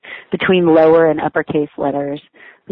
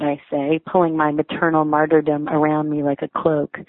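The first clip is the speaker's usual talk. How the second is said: ordinary talk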